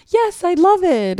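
high, drawn-out gushing voice; slow, high mock-enthusiastic quote; high-pitched imitation. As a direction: mimic them in an exaggerated squeal of delight. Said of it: "say it in that high-pitched voice".

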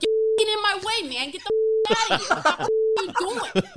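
loud, high mock-angry Boston accent; shouted Boston accent. Imitating two construction guys yelling at passers-by, angry Boston accent. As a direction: yell like an irate Boston construction worker. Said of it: "Where's all the Boston accents?".

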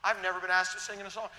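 ordinary talk, nothing marked

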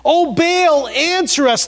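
high, loud plea to a god; crying out. A narrator's reading. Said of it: "they cried out".

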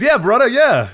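high, loud wrestler-style drawl; imitating the limo driver. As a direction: ham up the wrestler driver agreeing. Limo driver quoted claiming Kato.

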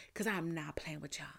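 a quiet, menacing aside; hushed warning under the breath; whispered threat. Retold or imitated. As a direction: whisper it, low and dead serious. End of telling the office off.

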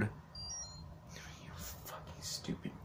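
hissed, breathless whisper aside; a sudden real whispered swipe; whispered insult. Real, not acted. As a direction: whisper the curse under your breath so nobody hears.

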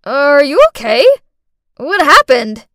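loud, alarmed questions; urgent concern. Acted. Senior student helping the fallen girl, loud and urgent. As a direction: rush to check on her.

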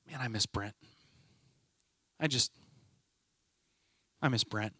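soft, low, halting sorrow; retold grief. Colleague at the office door missing a late friend, quietly grieving. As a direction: imitate a grieving friend, leaving long gaps.

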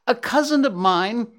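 squeezing in. Acted. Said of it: "The squirrel tried to squeeze in his voice".

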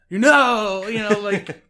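loud, hammed-up surfer-meets-British accent; mock Cage English accent. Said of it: "Mixed with an attempt at an English accent".

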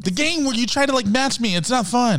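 high-pitched complaint; whiny protest. Real, not acted. Host complaining about being matched, whiny and high.

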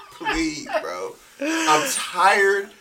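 begging while laughing; pleading laugh. Real, not acted.

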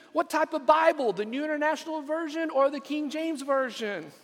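high excited gush, firing off questions; gushing excitement. Acted. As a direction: bubble over with giddy excitement. Rescuer gushing then quizzing.

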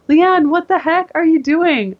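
a trainer's shocked scolding, retold; exasperated trainer. Trainer shocked her client has skipped periods, exasperated. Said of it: "And she's like".

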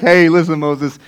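low, soft, soothing voice; God reassuring Moses. Acted. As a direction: answer the panic with quiet calm. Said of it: "And God's like".

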